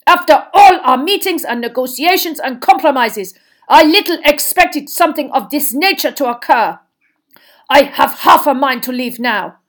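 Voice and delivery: high, loud, aggrieved tirade; outraged headmaster, emphatic; angry outburst. Acted. Headmaster threatening to leave after negotiations, angrily.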